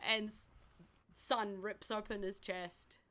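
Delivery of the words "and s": ordinary delivery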